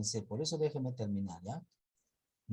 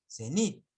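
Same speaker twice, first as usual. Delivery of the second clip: cenit with a louder, higher "nit"; stress on final syllable; 4 semitones higher, 5 dB louder than usual. Shown as a demonstration. Teacher showing where force falls, cenit stressed on "nit". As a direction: punch the "nit" of cenit upward. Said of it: "la mayor fuerza de voz en nit".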